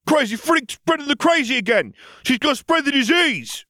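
very high, loud bully yell; bully shout. A narrator's reading. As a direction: bark it right in his face. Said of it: "suddenly he shouted".

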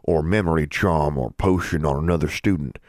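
measured, official warning in a dean's voice; stern dean. Acted. Dean warns a student about charms, stern. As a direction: lay down the rule as a dean would.

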